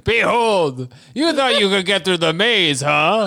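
high, hammed-up monster taunt; Gate Guardian. Monster taunting travellers in the maze, mock-menacing. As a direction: announce yourself as a gloating guardian, laughing at the end. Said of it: "Gate Guardian".

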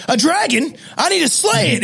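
dragon-slaying knight, rising high; heroic knight. Pretend. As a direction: play the brave knight, voice climbing. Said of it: "it'd be funny if this guy's like".

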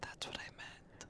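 fast hushed ASMR whisper. Acted. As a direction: whisper it into the mic.

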